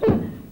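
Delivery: brief chuckle; laugh. Acted. Laughs after cheating accusation.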